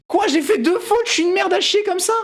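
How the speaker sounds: high-pitched indignant squawk; mock outrage. Streamer called out over two mistakes, mock outrage. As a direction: protest in exaggerated, high-voiced offence.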